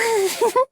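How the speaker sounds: short, high giggle. Acted. Saying five positive words to a plant, high giggle. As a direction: giggle at your own silly word list.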